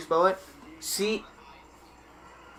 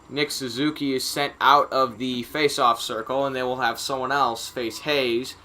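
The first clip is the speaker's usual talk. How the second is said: live hockey commentary, rising on the shots; sportscaster calling a loose puck in front; play-by-play; 6 dB louder than usual. Acted. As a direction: call the hockey action as it unfolds.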